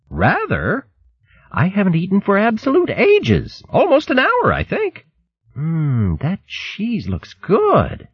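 put-on high, eager girlish voice; excited young hare maid.

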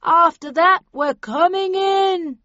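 high, loud, very slow ultimatum; stretched-out shouted warning; bullhorn shout. A narrator's reading. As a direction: call a deadline through a bullhorn. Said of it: "the voice into the bullhorn again".

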